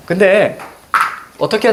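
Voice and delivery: ordinary talk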